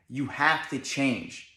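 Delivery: as if to his own reflection, drawn out; slow self-talk to the mirror. Retold or imitated. To himself in the bathroom mirror, slow and soft. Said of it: "I said".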